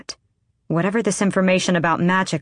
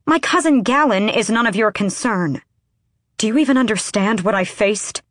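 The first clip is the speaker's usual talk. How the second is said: defensive anger; 5 semitones higher, 4 dB louder than usual. A narrator's reading. Heated argument, defensive.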